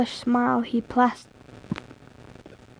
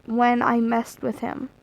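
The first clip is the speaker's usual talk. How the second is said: ordinary talk, nothing marked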